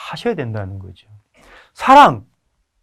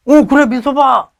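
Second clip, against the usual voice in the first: father calling back; 11 semitones higher, 8 dB louder than usual. Retold or imitated. Father answering loudly.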